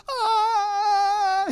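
long high-pitched "ah"; sustained comic wail of terror; mock scream. Boy hanging off runaway bike, long mock scream.

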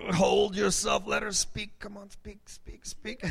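strained, high groan fading to urgent whisper; straining self-restraint. Retold or imitated. Husband forcing himself to let wife speak, straining whisper. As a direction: hold back an outburst, end in whispered urging. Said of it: "wanted to, like, lash out and attack".

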